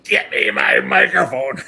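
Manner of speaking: loud, high, drawn-out shout. Retold or imitated. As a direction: bellow it like an outraged star, slowly. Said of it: "he yells at the sound man".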